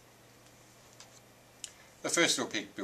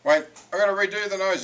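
high, loud interruption; interrupting voice; 11 semitones higher, 9 dB louder than usual. A narrator's reading. Illustrator stops the wolf mid-threat, interrupting loudly. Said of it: "interrupted a voice from nowhere".

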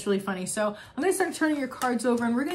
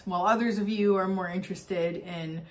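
usual speaking voice

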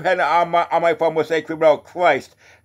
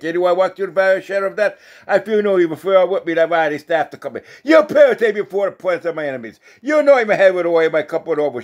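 raised, higher psalm-reading voice; psalm recitation; 4 semitones higher, 3 dB louder than usual. Real, not acted. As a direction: proclaim the psalm, lifting each verse's opening. Recites Psalm twenty-three.

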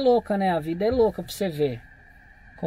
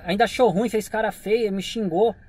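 usual speaking voice

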